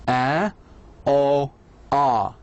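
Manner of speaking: "آ، أو، آ" as three separate vowels; three vowel sounds. Shown as a demonstration. Teacher shows learners the ways A is pronounced, isolated vowels. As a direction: sound out "آ، أو، آ", each held alone. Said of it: "ال A لفظت بطرق شتى".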